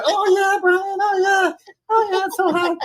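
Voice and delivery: high falsetto moaning; high mock female moan; mock woman's moan. Voicing woman, much higher.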